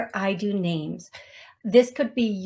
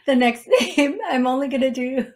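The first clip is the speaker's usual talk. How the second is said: higher voice breaking into laughter; laughing; 4 semitones higher, 4 dB louder than usual. Real, not acted. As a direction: try to carry on through the giggles. Cracking up while listing names.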